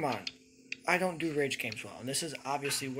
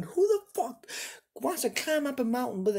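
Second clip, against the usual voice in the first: screaming rant; 10 semitones higher than usual. Real, not acted. Player ranting about the game, very high scream. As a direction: scream the question in disbelief.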